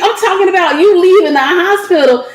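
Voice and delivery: usual speaking voice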